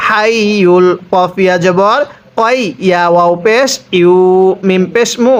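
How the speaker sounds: ordinary delivery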